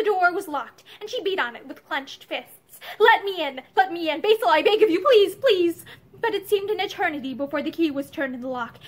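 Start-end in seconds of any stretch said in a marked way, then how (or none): 3.0-5.8 loud, raised desperate begging; frantic pleading. A narrator's reading. Mother at the locked door begging her husband, frantically. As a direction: beg urgently to be let in.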